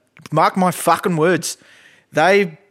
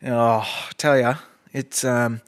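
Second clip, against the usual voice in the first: ordinary talk, nothing marked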